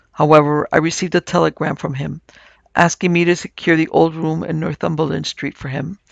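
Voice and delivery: ordinary delivery, nothing marked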